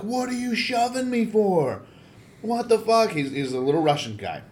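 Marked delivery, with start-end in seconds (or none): 0.0-3.1 high, drawn-out indignant protest; high-pitched aggrieved whine, retold; mock outraged kid. Little Russian guy's outraged reaction retold.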